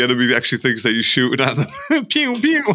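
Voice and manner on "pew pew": mock gunshot noises. Imitating gunfire in joke.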